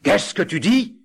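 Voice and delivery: high, loud ghostly demand; the ghost roars his question at them; terrible voice. Acted. Ghost to the poor brother, a terrible outraged voice. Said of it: "d'une voix terrible".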